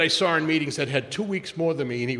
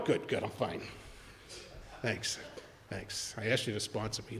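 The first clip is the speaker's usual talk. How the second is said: sullen mutter; 5 semitones lower, 12 dB quieter than usual. Retold or imitated. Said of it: "sulking at the sponsor".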